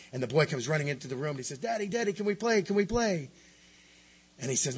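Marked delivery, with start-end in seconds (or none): 1.6-3.3 put-on high, eager little boy voice; five-year-old boy. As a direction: beg daddy to play like a small child. Little boy begs dad to play.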